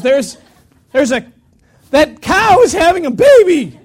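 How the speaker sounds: loud, high excited call; excited shout. Retold or imitated. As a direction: shout it out like you just spotted a calving cow. Somebody spots calving cow.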